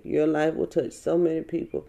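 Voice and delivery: ordinary delivery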